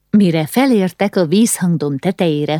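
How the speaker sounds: plain reading voice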